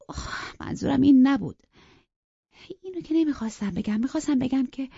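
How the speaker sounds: lightly voiced young woman, eager and justifying; quick, pressing to be understood; Catherine pleading. Catherine explains herself.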